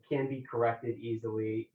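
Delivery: usual speaking voice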